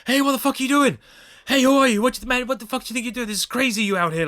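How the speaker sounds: high, loud yelled challenge; yelling at someone to back off, high-pitched; acted angry shout. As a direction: shout a stranger down in alarm. Acted challenge to silent clown.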